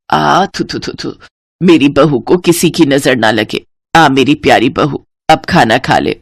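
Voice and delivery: tender blessing with thu thu; mother-in-law warding evil eye. Acted. (नज़र उतारती सास, धीमी आवाज़)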